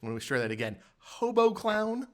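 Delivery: usual speaking voice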